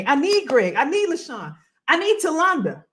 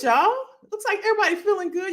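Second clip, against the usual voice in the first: normal talking voice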